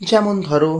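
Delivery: usual speaking voice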